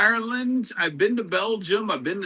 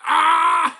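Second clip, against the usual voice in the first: a high, loud whoop; excited shout; 10 semitones higher, 6 dB louder than usual. Real, not acted. Streamer cheering after singing happy birthday, excited shout. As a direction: cheer as the song ends.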